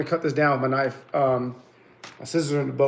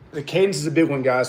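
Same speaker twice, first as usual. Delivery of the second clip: ordinary talk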